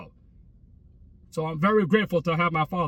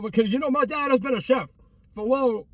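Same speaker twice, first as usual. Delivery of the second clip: normal talking voice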